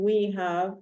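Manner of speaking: ordinary talk, nothing marked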